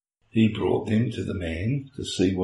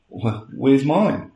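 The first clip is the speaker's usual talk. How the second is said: slow, higher, puzzled question; mock wondering Adam; 7 semitones higher, 6 dB louder, much slower than usual. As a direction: drag it out, searching for his match. Adam wonders after naming.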